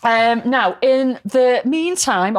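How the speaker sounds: ordinary talk, nothing marked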